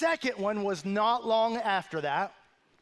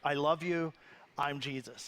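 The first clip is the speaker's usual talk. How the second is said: lower, calm, saintly little brother; serene Jesus voice; 6 semitones lower, 5 dB quieter than usual. Retold or imitated. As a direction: play a gentle, pious Jesus. Brother playing Jesus, quoted.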